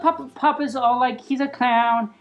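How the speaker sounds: usual speaking voice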